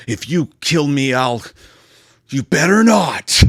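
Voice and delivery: hammed-up tearful threat, drawn out at the end; overdramatic mock crying. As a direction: play her weepy and melodramatic. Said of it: "I'm being overdramatic about it".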